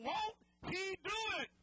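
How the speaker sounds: very high, loud preacher shout; loud shout of faith; shouted with conviction. Shown as a demonstration. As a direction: belt it to the back row. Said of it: "Oh, come on, say it like you mean".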